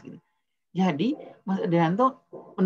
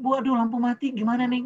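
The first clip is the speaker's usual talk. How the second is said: put-on scared child; 7 semitones higher, 4 dB louder than usual. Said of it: "Anaknya bilang".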